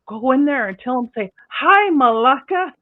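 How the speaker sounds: high, loud mock greeting; egging him on in a high voice; coaching a prank greeting. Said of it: "we go".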